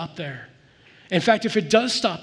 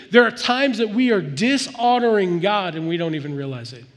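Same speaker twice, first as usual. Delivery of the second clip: louder, driving sermon delivery; fervent preaching; 2 semitones higher, 4 dB louder than usual. Acted. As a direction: preach it hard to the congregation. Preaching at full force.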